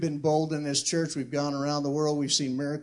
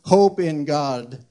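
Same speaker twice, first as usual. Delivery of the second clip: scripture reading; 5 dB louder than usual. Acted. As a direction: declaim the psalm, letting phrase ends settle. Reads scripture aloud.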